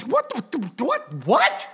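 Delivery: retold high, baffled outburst; mock yelling. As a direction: yell at the TV in disbelief, volume held back. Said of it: "I'm yelling at the triple threat going".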